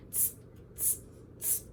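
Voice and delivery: short voiceless "tss" noises; monkey chatter. A narrator's reading. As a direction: imitate mocking monkeys with three soft hisses. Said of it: "him and said".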